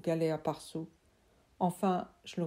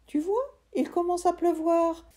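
put-on higher, loud boy's voice; trapped friend; 11 semitones higher, 4 dB louder than usual. Friend trapped in the tree urging Sam, high loud voice. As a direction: call out from inside the trunk.

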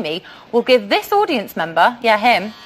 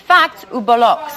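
mock French pronunciation of "fact ou bollocks"; French accent; 3 semitones lower than usual. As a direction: put on a playful French accent. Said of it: "Or as the French would say".

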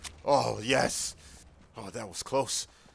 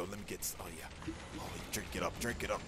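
hushed; 10 semitones lower, 11 dB quieter, faster than usual. Real, not acted. Drinking potion quietly.

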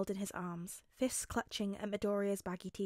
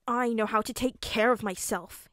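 put-on indignant small boy, a bit louder; grumbling; 8 dB louder than usual. A narrator's reading. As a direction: grumble it defensively, with firm stresses. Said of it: "he grumbled".

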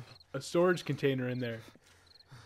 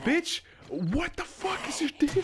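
shouting; 13 semitones higher, slower than usual. Real, not acted. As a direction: yell at the attacker. Zombie attacks him.

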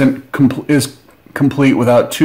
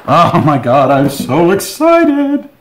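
high, slow, hammed-up excitement; mock excited; 4 semitones higher, slower than usual. Unboxer telling viewers how thrilled he is, hammed-up squeal. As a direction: stretch it out in a squealing fake thrill. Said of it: "the tone of my voice here ... do not properly convey how excited I am".

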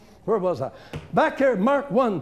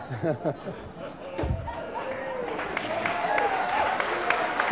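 high, delighted cackle; high-pitched laugh; 6 dB quieter than usual. Real, not acted. Preacher laughing at his own devil-taunting bit.

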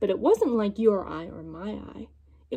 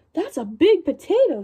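mildly higher amazed voice; wife amazed; 5 semitones higher, 5 dB louder than usual. Acted. Wife admiring the giant potato, mild amazed exclaim. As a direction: marvel at it too. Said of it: "said the wife".

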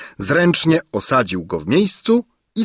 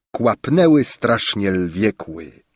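ordinary delivery, nothing marked

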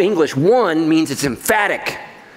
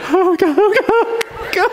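mock horrified groan; 14 semitones higher, slower than usual. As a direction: squeal oh God like a shocked churchgoer. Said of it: "Some of you are like".

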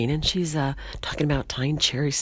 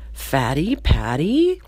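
slow, questioning drawn-out delivery; slowly puzzling out who it is; dawning recognition; 4 dB louder, much slower than usual. Acted. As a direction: stretch it out as a tentative question. Steve first recognises his old classmate.

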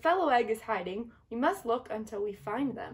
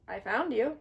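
ordinary delivery

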